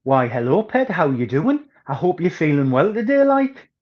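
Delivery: broad northeast English accent, lilting up at the end; demonstrating a working-class North East England accent; North East England dialect. Shown as a demonstration. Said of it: "Now there's a dialect. Let me ... give you an example".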